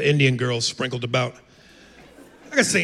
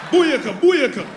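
high, loud Jamaican whoop; hammed-up booyaka chant; dancehall cheer; 12 semitones higher, 6 dB louder, slower than usual.

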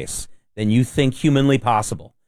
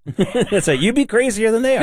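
laughing high; 11 semitones higher than usual. Real, not acted. Laughing at cohost's story.

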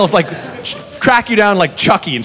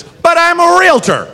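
indignant realtor; 12 semitones higher than usual. Retold or imitated. Drunk customer pleads with bartender, high indignant squeak. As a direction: insist on it like it settles everything. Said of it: "which is".

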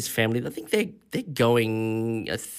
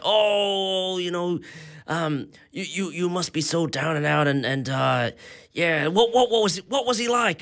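higher pitched, gushing sympathy; hammed-up American sympathy, pitched higher; mock earnest American; 3 semitones higher than usual. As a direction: overdo the stranger's heartfelt concern. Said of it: "this random American person".

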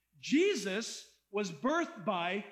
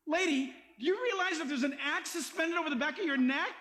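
ordinary talk

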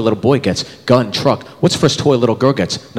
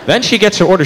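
ordinary talk, nothing marked

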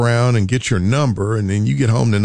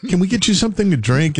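high-pitched gushing welcome; mock obsequious shop clerk, pitched way up; fawning store staff; 10 semitones higher than usual. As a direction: greet a big spender in a high, eager voice. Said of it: "And they go".

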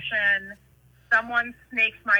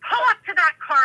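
higher, louder imitated order; mock road-rage command; 8 semitones higher, 5 dB louder than usual. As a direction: bark it like an irate granny passenger. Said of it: "she had road rage for real".

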